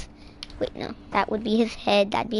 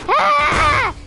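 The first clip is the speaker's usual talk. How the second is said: very high, loud scream; ragdoll's mock scream; 6 dB louder than usual. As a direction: shriek as the falling ragdoll. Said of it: "that's him screaming".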